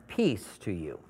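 low, soft, hushed "Peace to you"; gentle blessing. Acted. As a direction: say it like a tender blessing. Jesus speaks tenderly to disciples.

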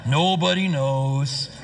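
low, slow, mopey donkey voice; gloomy Eeyore. Retold or imitated. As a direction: drag it out like a glum Eeyore. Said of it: "he's such an Eeyore".

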